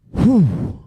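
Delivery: a loud, high "whew" of disbelief; loud shocked exhale. Retold or imitated. Dad stunned by his son's lockdown question, a loud exhale. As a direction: blow out a stunned breath.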